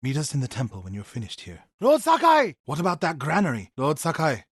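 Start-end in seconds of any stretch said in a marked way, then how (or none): 1.8-2.5 loud, high shout across the field; battle cry call; shouting a name. Acted. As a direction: yell his name to get his attention.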